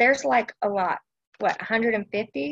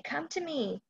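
higher and much softer, gentle invitation; tender calling; 6 semitones higher, 4 dB quieter than usual. Acted. Preacher voicing God inviting weary listeners, soft and tender. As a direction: call them in close and tender. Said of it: "he's calling you".

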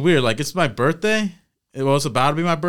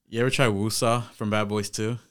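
usual speaking voice

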